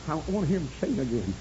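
normal talking voice

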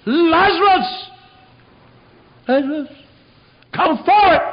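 huge high-pitched shout, drawn out; shouting at the top of his voice. Acted. Preacher as Jesus calling the dead man from the tomb, full shout. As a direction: call the name like summoning the dead. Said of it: "He shouted to the top of his voice".

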